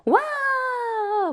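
high, loud, long cheering cry. Shown as a demonstration. Teacher demonstrating a joyful crowd cheer, high and loud. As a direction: shout it like a delighted crowd. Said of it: "환호성이라고 합니다".

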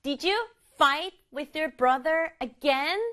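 "fight", "brother" and "again" stressed, slow question; slow rhythmic question leaning on "fight", "brother", "again"; stress on key words. Shown as a demonstration. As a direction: punch "fight", "brother" and "again", rising at the end. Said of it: "친구들도 강조해봐요".

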